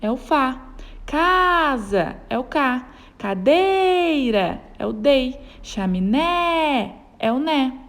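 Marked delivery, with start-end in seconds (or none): 1.1-2.2 casa with CA held long, higher; calling the word. Shown as a demonstration. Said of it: "chamando a palavra". 3.2-4.6 cadeira with DEI stretched long; calling the word. Shown as a demonstration. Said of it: "chamando a palavra". 5.6-7.0 chaminé with a long final NÉ; calling the word. Shown as a demonstration. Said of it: "chamando a palavra".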